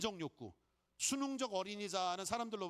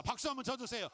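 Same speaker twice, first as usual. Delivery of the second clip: ordinary talk, nothing marked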